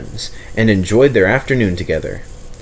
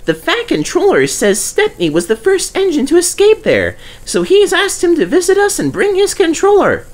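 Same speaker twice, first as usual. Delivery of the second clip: high, eager little engine voice; Percy's voice; 4 dB louder than usual. Acted. Percy reminding Douglas, in a high eager voice. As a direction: play the small cheeky tank engine.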